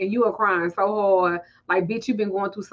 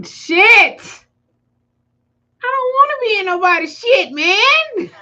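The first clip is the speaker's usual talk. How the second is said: much higher and louder, laughing; laughing outburst, wants no drama; exasperated; 10 semitones higher, 8 dB louder than usual. Real, not acted. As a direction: protest with a shrieking laugh. Asked to mediate, exasperated laugh.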